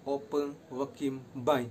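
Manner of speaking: "opo wakimbai" very slow, stress on "opo"; slow pronunciation. Shown as a demonstration. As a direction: teach "opo wakimbai" syllable by syllable. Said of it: "Pronunciation-nya kayak gini".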